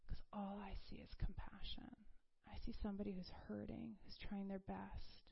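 very soft, breathy near-whisper; compassionate reassurance. Retold or imitated. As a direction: voice a tender God, gently and quietly. God answers her with compassion.

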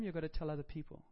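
ordinary delivery

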